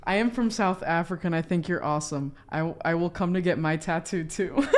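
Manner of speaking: normal talking voice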